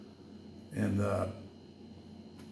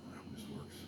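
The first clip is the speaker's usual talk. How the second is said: whisper; 21 dB quieter, much faster than usual. Real, not acted. Muttered aside, unvoiced.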